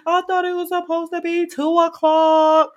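mock her with a high prim voice; exaggerated imitation of the late therapist. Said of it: "Why are you using this voice?".

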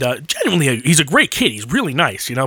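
ordinary talk

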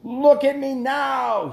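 high, loud, slow anguished shriek; the old king shrieking at his own wasted body. Acted. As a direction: drag it out in a high despairing shriek. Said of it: "he shrieked again".

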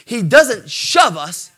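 ordinary delivery

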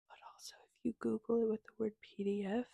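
quick hushed whisper. Real, not acted. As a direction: whisper it like a secret. Aside about free PDF.

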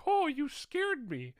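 put-on very high, slow quavering old lady; startled old woman. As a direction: squeak it like a frightened old lady. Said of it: "this old woman was like".

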